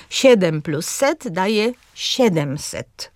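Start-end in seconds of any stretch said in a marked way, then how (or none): 1.9-3.1 SIE-dem-set, first syllable stressed; slow "siedemset" with stress at the start; antepenultimate stress. Shown as a demonstration. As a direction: hit SIE in "siedemset", slowly. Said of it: "również z akcentem na trzecią sylabę".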